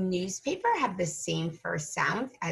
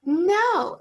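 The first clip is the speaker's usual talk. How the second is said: ordinary talk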